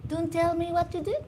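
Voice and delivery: high-pitched mock Indian accent. Comedian demonstrating Indian accent with a retort, high Indian accent. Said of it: "Indian accent".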